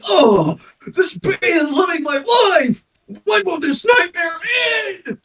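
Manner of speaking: very high, loud mock wail; hammed-up anguish. Quoting the bee movie, hammed-up anguish. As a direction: imitate a movie character's despairing cry. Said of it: "He was like".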